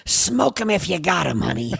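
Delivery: high put-on character voice, falling away; comic imitation of a radio character; Marge. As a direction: start high, let the last word drop.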